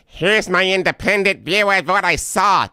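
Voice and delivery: high mocking impression of a spokesperson; mocking quote. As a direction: parody her self-important claim. Mocking the press secretary.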